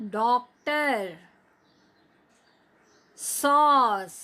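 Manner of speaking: डॉक्टर and सॉस drawn out with an open ऑ; slow model words. Shown as a demonstration. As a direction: say them slowly for repeating. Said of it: "बोलिए बच्चों".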